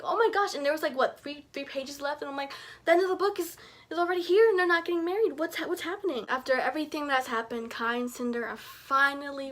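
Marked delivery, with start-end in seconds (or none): 0.0-0.5 excited gasp. Real, not acted. Reacting to the final pages. 2.8-6.2 higher and louder, rushed panic; frantic disbelief. Real, not acted. Panicking no wedding in final pages.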